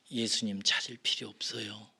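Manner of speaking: usual speaking voice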